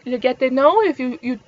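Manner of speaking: ordinary talk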